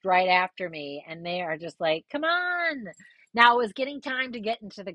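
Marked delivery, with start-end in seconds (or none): 2.1-2.9 high, drawn-out call; retold urging call. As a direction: urge a runaway friend along. Friends calling after her.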